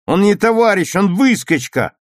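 high, loud denunciation; contemptuous shout. A narrator's reading. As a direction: shout him down with scorn. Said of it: "презрительно крикнул Витя". (презрительный выкрик)

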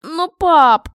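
high, loud plea to dad; wailed appeal; pleading protest. A narrator's reading. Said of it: "взмолилась я".

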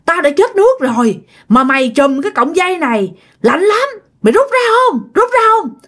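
begging ghost. Acted. Ghost begs the grandfather to pull out the rope, pleading. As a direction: whine like a freezing spirit.

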